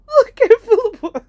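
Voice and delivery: high, very loud exclamation; amused shout. Retold or imitated. Uncle pointing out terrified girl at table, loud exclamation.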